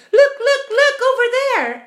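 very high, loud shriek; excited shout. A narrator's reading. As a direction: shout to make everyone turn. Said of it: "shouted the ladybird".

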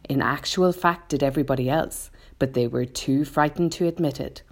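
ordinary delivery

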